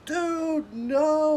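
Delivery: high, loud panicked plea; panicked denial. Retold or imitated. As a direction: plead in a panic with a gun in your face. Terrified denial facing a shotgun.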